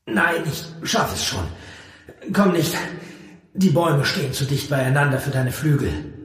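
high, pressed voice; pained, urgent protest; strained with pain. A narrator's reading. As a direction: call it out through the pain. Injured hero waving off help.

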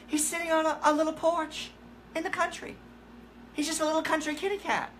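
high-pitched psychic relaying a vision; pet psychic's voice. Retold or imitated. Psychic tells owner where the missing cat is, high put-on voice. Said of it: "She said".

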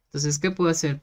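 usual speaking voice

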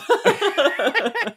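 bright burst of laughter; high laugh. Real, not acted. Laughing at own retelling.

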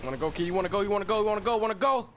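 goading the enemy, rising; taunting, building up. Real, not acted.

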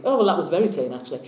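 normal talking voice

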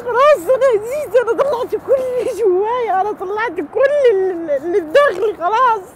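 high and loud, voice straining with emotion; loud emotional outburst. Real, not acted. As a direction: pour it all out loudly, barely holding together. Upset woman venting her feelings.

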